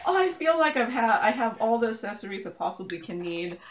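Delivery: hammed-up self-quote, fast at the end; mock self-quote. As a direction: parody your own confident claim, starting high and loud. Mock-quoting her own famous last words.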